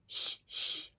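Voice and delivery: hushed unvoiced shh; shushing. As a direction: hush them like a secret. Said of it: "said Rabbit".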